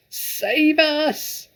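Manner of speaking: high, loud scream for help; terrified scream. Acted. Mother throttling her husband, screaming high and loud. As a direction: shriek in panic. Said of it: "the mother screamed".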